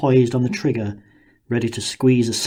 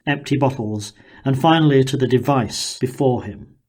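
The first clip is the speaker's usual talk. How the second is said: ordinary delivery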